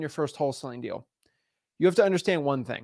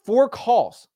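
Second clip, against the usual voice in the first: high, louder disbelieving echo; mock disbelief at a lazy caller; incredulous; 7 semitones higher, 5 dB louder than usual. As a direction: repeat it like you can't believe it. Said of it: "And I'm like".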